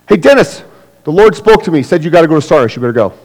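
high and loud, bossing Dennis; pushy know-it-all. Acted. Churchgoer pressing Dennis to go to Sardis, high and bossy. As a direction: lean in and tell him what God said.

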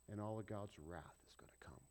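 hushed ominous whisper; dramatic whisper of judgment. Acted. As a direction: whisper the coming wrath. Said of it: "whispered wrath".

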